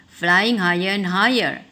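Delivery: plain reading voice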